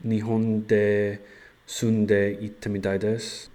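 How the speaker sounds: slow, loud learner Japanese; carefully voiced Japanese answer; japanese learner accent. Shown as a demonstration. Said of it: "I wonder if I should just say".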